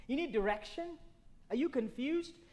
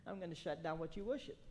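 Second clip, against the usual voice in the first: low, hushed, quick aside as God; quiet warning; 7 semitones lower, 11 dB quieter, faster than usual. Acted. God warning those who worship other things, quiet warning. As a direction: say it low and under the breath, just after a chuckle.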